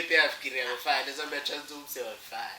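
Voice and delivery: light, jokey delivery breaking into laughter; half-laughing, playful tip-off; joking suggestion. Acted. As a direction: float it to a friend as a joke. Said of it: "say it in a jokingly way".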